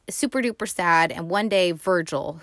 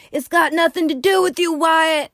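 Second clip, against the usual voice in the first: much higher, louder defiant retort; defiant brother; 9 semitones higher, 5 dB louder than usual. Retold or imitated. Younger brother talking back to Wyatt, loud and high. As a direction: snap back at your big brother. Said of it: "And his brother's like".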